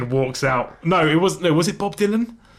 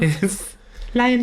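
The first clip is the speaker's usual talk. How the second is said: high, slow falsetto; put-on pop-star squeak; 10 semitones higher, slower than usual. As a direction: draw it out in falsetto. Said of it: "poor [name] impersonation".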